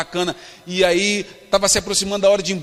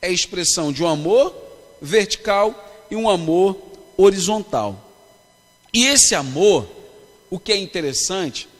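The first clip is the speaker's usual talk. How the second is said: fervent preaching; 5 dB louder than usual. Acted. Pastor preaching to a youth congress, fervent and emphatic. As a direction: preach it to the crowd, building.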